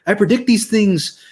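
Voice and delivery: high Irish brogue, mock swagger; Irish accent, cocky and bold; Conor McGregor impression. Quoting Conor McGregor to gloat, in a mock Irish accent. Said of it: "as Conor McGregor would say".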